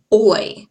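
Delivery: "oi." punched out, gliding o to i. Shown as a demonstration. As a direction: say "oi" loudly, clear glide. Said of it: "You can hear me saying oi".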